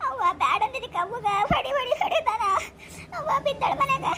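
put-on high, sobbing girl pleading through tears; crying niece. Weeping niece tells her aunt about the wedding, sobbing. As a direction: choke the words out between sobs.

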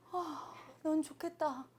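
put-on soft, slow envious sigh of a child; wistful envy. Lonely child envying the hugged boy, soft and slow. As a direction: say it under your breath, longing.

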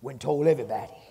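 normal talking voice

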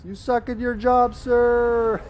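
loud, high, drawn-out yell; high comic holler of blame; mock scream. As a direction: scream it out in mock outrage, stretching the last word. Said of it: "she screams".

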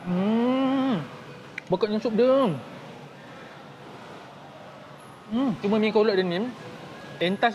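talking while eating; sipping soup between words; mouth full chewing. Real, not acted. Seen 2: Chews throughout; sips broth from small bowl between words; noodles earlier.

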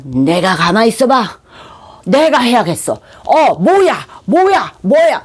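bossy know-it-all taking over; mock-haughty, rising to high impatient exclaiming; arrogant, self-important. Proud person insisting on doing it alone, haughty. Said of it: "오만하고 거만해서".

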